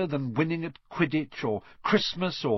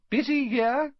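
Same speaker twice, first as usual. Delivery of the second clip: much higher, kindly mother voice; motherly Mrs Weasley; 12 semitones higher, 4 dB louder than usual. A narrator's reading. Mrs Weasley greets the boys, higher and kind. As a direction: smile down at the boys.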